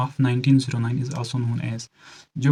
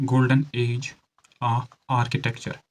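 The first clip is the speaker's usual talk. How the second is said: slow, broken into chunks with pauses; slow dictation; much slower than usual. Shown as a demonstration. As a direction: dictate it piece by piece for note-taking. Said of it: "धीरे से बोल रहा हूँ".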